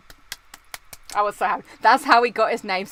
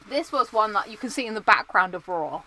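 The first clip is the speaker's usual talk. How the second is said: normal talking voice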